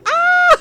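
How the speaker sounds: loud high shriek of fright; startled scream. Retold or imitated. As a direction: scream back in panic. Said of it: "And then you go".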